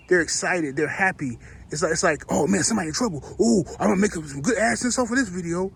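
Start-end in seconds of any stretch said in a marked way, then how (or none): 2.3-5.7 lightly voiced gleeful creator; a hint of eager glee over a scandal; mock excitement.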